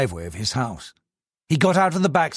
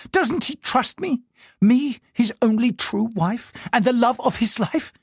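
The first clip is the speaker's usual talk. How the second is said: higher, fuming wife rants; seething; 10 semitones higher than usual. Acted. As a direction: vent her fury to herself. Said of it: "Diane found herself seething".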